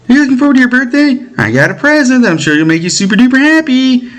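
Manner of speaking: put-on high, quick excited kid sister voice; high-voiced little sister teasing her brother; girlish little sister. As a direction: play the eager younger sister, high and bright-eyed.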